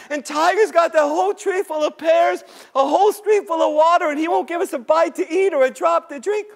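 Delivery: high, plaintive whine; sad, afraid creatures. Acted. Starving creatures complain to Rabbit, high and woeful. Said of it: "looking so afraid and so sad".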